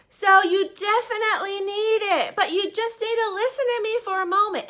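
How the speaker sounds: hammed-up tearful sales plea; mock desperate pleading. Desperate pitcher begging the crowd.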